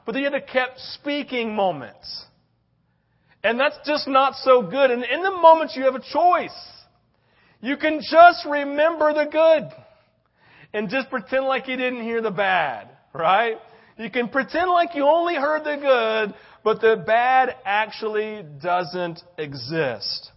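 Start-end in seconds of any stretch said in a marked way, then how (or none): none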